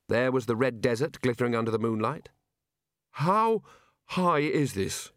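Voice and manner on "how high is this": slow, croaky, scared question; croaking. Acted. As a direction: croak it out in dread. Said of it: "he croaked".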